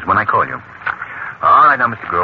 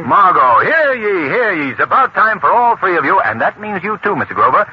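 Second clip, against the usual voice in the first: high-pitched, ringing proclamation; mock town crier; 9 semitones higher than usual. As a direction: proclaim it like a herald to the room.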